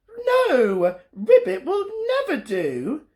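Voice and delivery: very high owl voice; high little owl. Acted. Olive turning down the frog. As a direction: refuse it in a tiny owl voice. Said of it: "said Olive".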